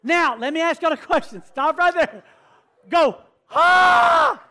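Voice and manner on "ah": loud, very high scream; piercing high shriek. Shown as a demonstration. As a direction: scream it out full voice. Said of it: "Okay, scream it out".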